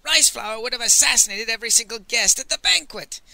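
high, loud indignant outburst; angry. A narrator's reading. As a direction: rant at the absurd suggestion. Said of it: "Master Li said angrily".

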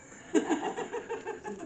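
high burst of laughter; big laugh. Real, not acted. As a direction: laugh out loud, high and free. Laughing at leg joke.

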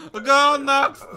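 put-on high, very loud Russian-accented call; shouting to the next person. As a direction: bellow it down the queue. Border officer calling the next person.

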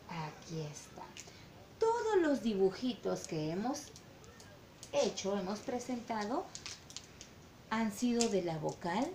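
ordinary delivery

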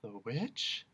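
quiet frightened question; trembling. Acted. Milo asks the jailer in fear, trembling quietly. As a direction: ask it with dread. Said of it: "trembled Milo".

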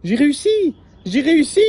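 high, loud triumphant cheer; jubilant. Acted. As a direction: crow with joy at his success. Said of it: "jubila Albert".

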